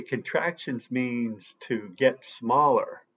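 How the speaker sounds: ordinary delivery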